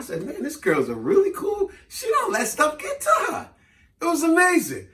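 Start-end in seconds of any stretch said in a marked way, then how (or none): 0.2-3.5 high-pitched mock amazement; amazed admiration. Man marvelling at the easygoing girl, high mock amazement. Acting out his admiring reaction.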